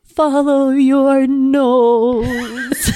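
very slow, high mascot catchphrase; exaggerated, drawn-out mascot impression; Toucan Sam. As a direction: stretch every word like an overblown cartoon bird. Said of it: "It wasn't like that".

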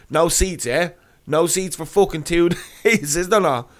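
high, gloating mock question; retold taunting. Passenger returns to airline staff with ticket, acted taunt. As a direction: needle them by throwing their words back.

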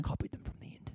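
hushed, guilty whisper; whispered confession. Real, not acted. Guest owns up to host about copying quiz questions, whispered. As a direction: whisper it as if owning up. Sheepish confession after "is this bad?.

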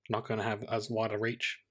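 ordinary delivery

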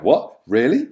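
high, loud "What? Really?"; stunned, can't believe it; amazed. Retold or imitated. Replaying his reaction to the surprise offer, a high, loud amazed outcry.

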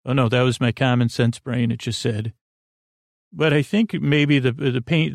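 ordinary delivery

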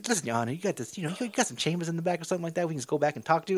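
imitating a player hitting on the judge; smooth flirt. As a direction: charm the judge with hushed pickup lines. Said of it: "straight hitting on the judge".

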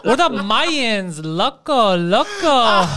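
slow, drawn-out flamboyant boast; theatrical camp voice. Acted. Theater guy boasts to his biker gang, theatrical. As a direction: savor each word like a hammy actor. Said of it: "That's a theater voice".

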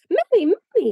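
put-on high, loud little-child squeal; excited scream. A narrator's reading. Little Phronsie screams for Mummy, high and excited. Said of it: "screamed Phronsie".

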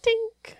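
high, tiny metallic tink. Shown as a demonstration. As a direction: make a little tink noise. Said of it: "you just need to get his tink noise".

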